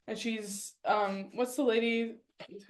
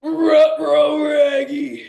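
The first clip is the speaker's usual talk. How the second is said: high, loud dog-voiced shout; Scooby-Doo voice; 4 semitones higher, 11 dB louder, much slower than usual. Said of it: "it's Scooby".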